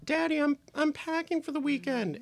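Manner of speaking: put-on very high little-kid voice; imitated kid. Mimicking a kid telling Daddy about packing, high child voice. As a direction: pipe up as a small child. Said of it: "The kid is like".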